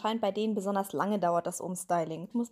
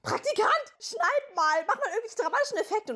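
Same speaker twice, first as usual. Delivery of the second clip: mock boss order; 13 semitones higher than usual. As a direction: play a careless boss barking orders. Imitating a boss ordering an intern. (leicht gespielter Chef, der den Praktikanten herumkommandiert)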